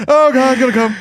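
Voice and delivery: high, loud mock Canadian; Canadian accent. Joining in on the Canadian friend story, loud mock Canadian accent. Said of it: "I never noticed the Canadian accent".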